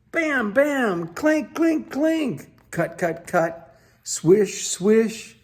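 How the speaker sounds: high, bouncy sound effects; bang, clink and swish like a busy workshop; playful work noises. Acted. Building noises with onomatopoeia.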